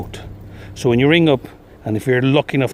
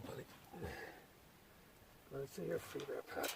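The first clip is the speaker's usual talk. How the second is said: far off, calming a stuck sheep; murmured reassurance from a distance; soothing a sheep; 2 semitones higher, 25 dB quieter, faster than usual. Real, not acted.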